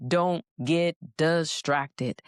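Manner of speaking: ordinary talk, nothing marked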